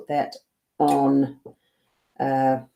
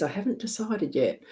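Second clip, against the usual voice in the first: laughing; 6 semitones higher, 7 dB quieter than usual. Real, not acted. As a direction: say it through a soft laugh, softer. Admits undecided totem, laughing.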